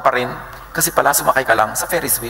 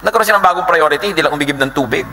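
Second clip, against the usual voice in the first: usual speaking voice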